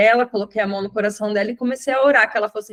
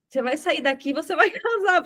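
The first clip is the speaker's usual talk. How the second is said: telling her she must marry while laughing; firm words breaking into a laugh; 6 semitones higher than usual. Retold or imitated. Preacher to the woman in the ICU, laughing as she speaks. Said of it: "Falei desse jeito pra ela, foi muito engraçado".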